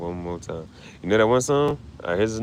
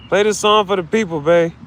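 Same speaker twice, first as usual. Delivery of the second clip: high, louder plea; calling out to Bailey; 10 semitones higher, 6 dB louder than usual. Real, not acted. Grieving owner calls to his dead dog, excited. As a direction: call up to her, pleading.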